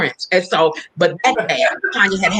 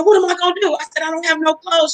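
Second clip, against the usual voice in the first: very high, rushed excited panic; 10 semitones higher than usual. Retold or imitated. Girl to her mom before the flight, high excited panic. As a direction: squeal it out, overwhelmed.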